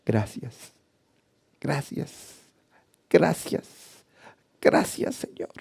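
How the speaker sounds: soft, near-whispered thanks, fading away; hushed thanks. Retold or imitated. Preacher reliving his kneeling prayer, whispering thanks. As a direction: breathe the thanks out almost silently.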